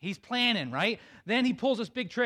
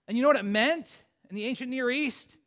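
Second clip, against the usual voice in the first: ordinary talk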